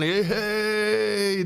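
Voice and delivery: high, drawn-out party whoop; hammed-up long "hey"; mock celebration. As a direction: cheer the reunion with glee. Mock cheer for father and son.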